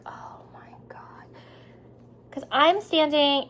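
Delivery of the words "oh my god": slow breathless whisper; slow horrified whisper. Retold or imitated. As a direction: mouth it in quiet dread. Mom panicking under her breath.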